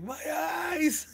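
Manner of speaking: high, stretched-out comic wail; mock agonized cry. Reviewer mimics a comic character he loves, hammed-up wail.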